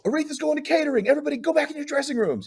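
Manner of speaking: high, fast, urgent staffer voice; squeaky rushed backstage announcement; frantic staffer. Retold or imitated. Staff clearing the room, voiced.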